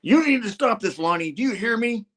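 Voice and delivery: high-pitched stern demand; insistent mock shout. Spidey throwing punches at Lonnie, high insistent demand. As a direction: plead firmly as the hero mid-fight. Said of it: "He's like".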